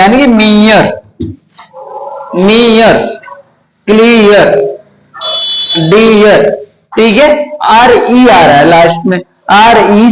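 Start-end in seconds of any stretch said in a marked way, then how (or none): 0.3-6.5 मीयर, क्लियर, डियर each with long 'ee' plus 'yar'; rule-based pronunciation. Shown as a demonstration. Teacher drills example words for students, demonstrated ee-yar endings. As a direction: model मीयर, क्लियर, डियर one by one, stretching the ee. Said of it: "इसका उच्चारण यही हो गया".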